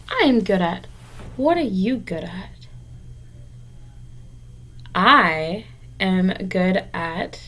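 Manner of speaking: ordinary talk, nothing marked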